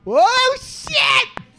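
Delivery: high, loud "oh shit" yell; excited shout. Real, not acted. Reacting to the smoke machine, excited shout. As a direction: whoop it out way up high.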